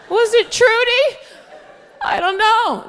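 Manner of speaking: very high, loud mock mom; desperate guessing. Mom guesses her security answer, high desperate mock yell. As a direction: squeal it like a flustered mom.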